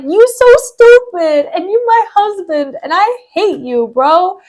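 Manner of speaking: high, drawn-out comic scolding; hammed-up indignant wife; mock exasperation. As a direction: wail the insults, stretching the words. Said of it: "Amelia's just like".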